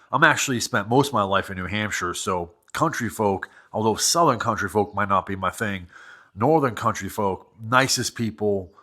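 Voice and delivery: ordinary talk, nothing marked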